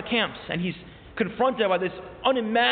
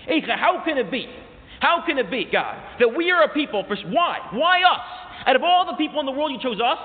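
higher-pitched, insistent questioning of God; anguished appeal; 5 semitones higher, 3 dB louder than usual. Acted. Preacher asks God why his people suffer, anguished appeal. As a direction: plead with God, punching the key questions. Acted protest to God in lament.

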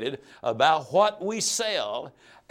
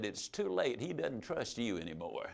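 much softer, letting it sink in; quiet final verdict; 4 semitones lower, 11 dB quieter than usual. Retold or imitated. Sister quietly telling the salesman it's too late, much softer. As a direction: drop the voice to deliver bad news gently.

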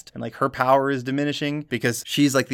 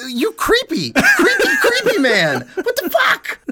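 much higher, louder, laughing at a creep; a reviewer laughing in disbelief at a creepy cop; laughing disgust; 4 dB louder, slower than usual. Retold or imitated. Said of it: "And I'm just like".

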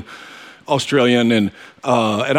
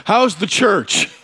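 mock enthusiastic greeting; 7 semitones higher than usual. [name] greets wrong Darren warmly.

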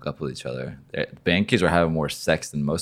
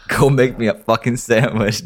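hammed-up domineering husband, louder and higher, laughing through it; mock macho husband; 5 semitones higher, 6 dB louder than usual. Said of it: "No, I'm kidding".

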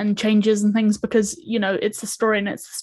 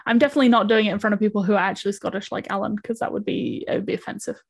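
ordinary delivery

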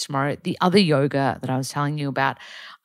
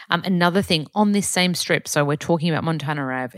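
normal talking voice

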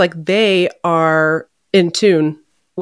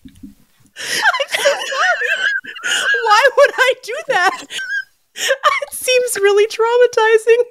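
high, crying with laughter; laughing apology; slower than usual. Retold or imitated. Apologising to Amanda while crying with laughter. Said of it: "And I was like".

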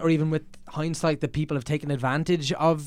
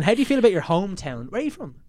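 ordinary talk

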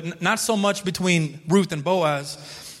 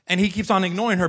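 ordinary talk

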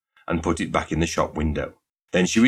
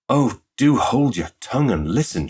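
higher-pitched, exasperated order; exasperated hushing; 6 semitones higher than usual. A narrator's reading. Shop girl silences chattering suitor, exasperated. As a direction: make him stop and listen.

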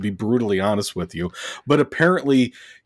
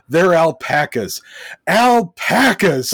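mock yell building to a drawn-out scream; hammed-up movie-star meltdown, slow and rising; imitated yell; 5 semitones higher, 4 dB louder, slower than usual. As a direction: start loud, then stretch the last word louder and higher. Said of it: "he yells the words".

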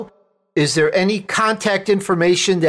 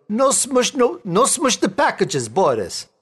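higher, broken mock Russian accent; 6 semitones higher than usual. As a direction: ham up a Russian bandleader's broken English. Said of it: "this Russian band ... he goes".

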